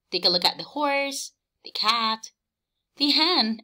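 ordinary talk

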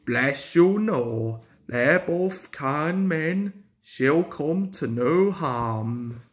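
slow, gentle reassurance; calm a worried friend; reassuring. Acted. Driver reassuring Duck.

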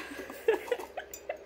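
high airy laugh of joy; breathy laugh. Real, not acted. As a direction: laugh out, overwhelmed. Overwhelmed by gift.

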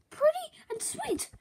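high, strained forced "sorry"; squeaky forced apology. Acted. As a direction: apologise under duress in a squeak. Forced compliment while pinned.

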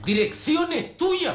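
high, loud, drawn-out accusation; angry accusation. Acted. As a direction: hurl it slowly at full pitch. Acusación en un interrogatorio. (acusación furiosa, aguda y alargada)

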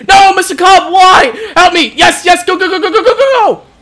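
high, loud urgent pleading into cheering; high-pitched excited shouting; frantic yelling. Real, not acted. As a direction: urge them on frantically, then whoop. Frantic play, then a goal.

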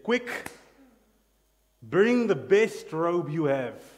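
booming excited command; urgent shout. Acted. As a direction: cry it out to the servants, slowing on the robe. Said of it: "the father ignored his speech and he said".

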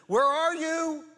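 high, loud desperate call of someone lost; anxious cry. Retold or imitated. Worried believer calling out to God, loud and high. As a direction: cry it out like a frightened seeker. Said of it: "you're asking the question".

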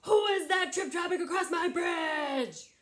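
high, loud troll shout; mean troll. Acted. Mean troll challenging whoever crosses his bridge, shouting. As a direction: roar it like an angry troll. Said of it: "that mean troll comes out and says".

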